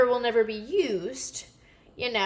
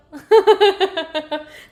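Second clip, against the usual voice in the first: laugh at own accent; 6 semitones higher, 9 dB louder than usual. Real, not acted. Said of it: "That's my little accent".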